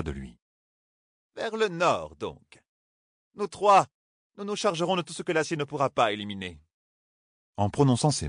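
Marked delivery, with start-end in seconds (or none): 1.4-6.6 voix aiguë, sèche et sûre; Katsuane autoritaire. A narrator's reading. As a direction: ordonne sans hausser le ton. Katsuane décide. (Katsuane commande le groupe, aiguë et autoritaire)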